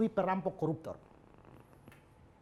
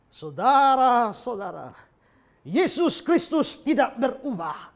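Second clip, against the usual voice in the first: slow, loud, higher mock sermon voice; drunken preaching; 5 semitones higher, 11 dB louder, slower than usual. Drunk theology student preaching on campus, hammed-up drunken sermon. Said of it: "dia khotbah".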